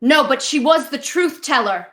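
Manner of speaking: high, insistent retort; heated objection. Acted. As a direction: push back hard in the argument. Acted argument with sister.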